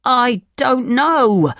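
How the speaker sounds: high, slow, plaintive protest; unhappy. A narrator's reading. Thief answering the angry leader, unhappy. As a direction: plead helplessly in a high, stretched voice. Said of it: "said the unhappy thief".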